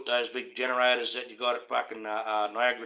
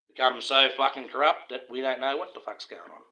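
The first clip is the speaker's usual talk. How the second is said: normal talking voice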